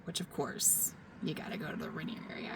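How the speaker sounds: hushed, breathy whisper; whispered aside. Real, not acted. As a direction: whisper it like a secret. Aside about Rainier.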